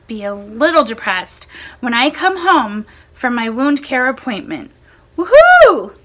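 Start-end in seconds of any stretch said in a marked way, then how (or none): 5.2-5.9 a sudden high, loud whoop of joy; happy cheer. Real, not acted. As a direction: let out a real cheer of relief. Cheering good medical news.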